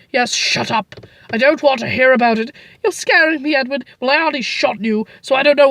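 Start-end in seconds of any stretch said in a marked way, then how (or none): none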